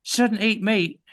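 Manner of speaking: high vegan voice through pursed lips; effete vegan. Pretend. Student tries the coach's tip of aiming at teeth, effete vegan. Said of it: "aim for your teeth when you're speaking".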